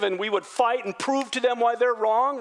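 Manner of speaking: ordinary talk, nothing marked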